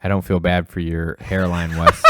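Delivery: ordinary talk, nothing marked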